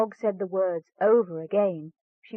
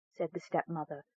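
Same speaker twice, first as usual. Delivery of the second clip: ordinary delivery, nothing marked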